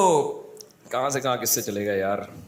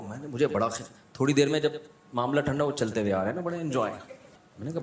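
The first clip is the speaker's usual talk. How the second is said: usual speaking voice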